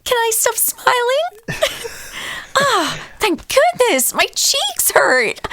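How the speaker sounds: high, slow, drooping doll voice; a doll dropping the smile, relieved; weary Barbie voice. Acted. Playing Barbie after the guests leave, high and slow with relief. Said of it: "I'm Duergar Barbie".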